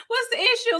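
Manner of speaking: high squeaky incredulous question; mock disbelief. Vlogger asking what the issue is, high mock disbelief. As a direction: shoot it way up in fake shock.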